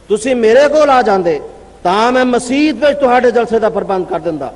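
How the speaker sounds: ordinary delivery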